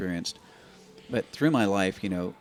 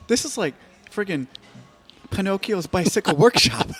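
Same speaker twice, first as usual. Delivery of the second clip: much higher and louder, shrill mock-wife voice; 12 semitones higher, 10 dB louder than usual. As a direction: ham up the wife's shocked outburst. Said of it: "She goes".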